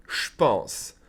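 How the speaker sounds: "je pense" run together as "shpense"; blended contraction. Shown as a demonstration. Teacher demonstrates French contraction, "je pense" as "shpense". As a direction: drop the E, turn "je" into "sh". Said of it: "blend the P and the J together".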